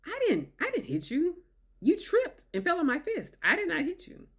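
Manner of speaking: mock denial. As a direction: deny it, wide-eyed and high. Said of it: "Rewriting history".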